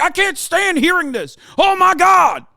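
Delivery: high-pitched, shrieking parody of a triggered listener; whiny mock outrage. Streamer mocks critics who can't stand hearing this, whiny shriek. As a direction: squeal it like a fragile snowflake, ending in a shout. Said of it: "that you're like".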